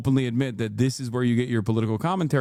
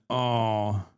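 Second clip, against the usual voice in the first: ordinary talk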